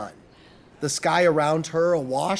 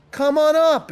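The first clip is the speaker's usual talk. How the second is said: high, louder welcoming call; upbeat, raised invitation; breezy invitation; 8 semitones higher, 5 dB louder than usual. A narrator's reading. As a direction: wave them in with the voice. Eric calls an invitation upstairs.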